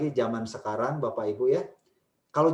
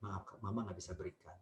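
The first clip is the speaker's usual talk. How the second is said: soft low calm refusal; gentle firm mother; 6 semitones lower, 15 dB quieter than usual. Shown as a demonstration. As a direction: say no softly but firmly. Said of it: "Itu tegas menurut saya".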